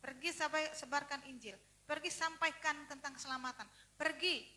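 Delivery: loud, forceful order; commanding. Retold or imitated. God orders his soldier to go preach, loud and commanding. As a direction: send them out with a ringing command. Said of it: "Tuhan perintah".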